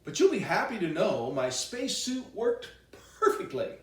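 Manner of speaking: plain reading voice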